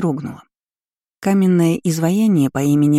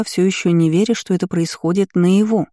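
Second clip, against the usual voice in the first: usual speaking voice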